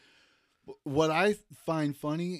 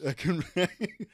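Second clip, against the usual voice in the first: laughing; 4 semitones higher than usual. Real, not acted. Joking about writing jokes.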